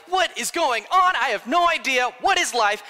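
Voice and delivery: loud, high-pitched hammed-up panic; exaggerated bewildered yelling; mock freaking out. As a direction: play the disciples losing it, comically frantic. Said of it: "they're freaking out hard".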